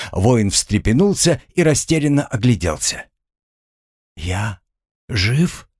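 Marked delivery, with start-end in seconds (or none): 4.2-5.7 disbelieving. Acted. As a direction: pause after я, then lift жив in doubt. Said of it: "недоверчиво проговорил он". (недоверчивое, тихое «жив?» с подъёмом)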